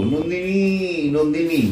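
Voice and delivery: Nandini called out high and slow; calling Nandini. Shown as a demonstration. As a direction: call Nandini slowly, high and drawn out. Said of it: "এবার কিসে জোর দেবো". (উঁচু, টানা স্বরে নন্দিনী বলে ডাকা)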